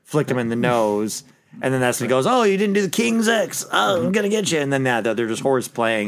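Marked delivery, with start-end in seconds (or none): 2.2-4.6 high-pitched, playful goofball taunt; Ernest. Acted. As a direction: raise your voice high for a goofy tease. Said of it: "Was that your Ernest impersonation?".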